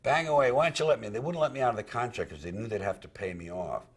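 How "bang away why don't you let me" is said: retold defiant shout. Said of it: "And I'd say".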